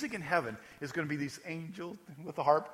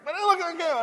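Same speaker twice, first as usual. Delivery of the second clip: put-on much higher proud kid's voice; child voice; 12 semitones higher, 5 dB louder, faster than usual. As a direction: announce it like a small child. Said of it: "your grandkids or your kids come up to you".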